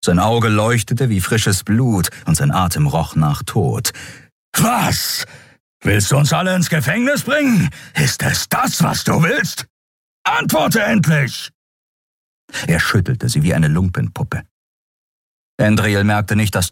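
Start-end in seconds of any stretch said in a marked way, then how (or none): 4.6-11.5 high-pitched, enraged shouting; raging questions hurled out; furious interrogation. A narrator's reading. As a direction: demand an answer, shaking the victim. Enraged Keru interrogates the woman he chokes.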